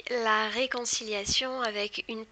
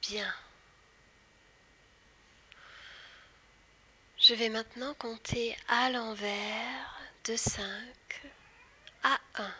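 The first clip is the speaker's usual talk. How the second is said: slow whispered guided hypnosis. Acted. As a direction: whisper slowly, guiding into trance. Leads a hypnosis induction.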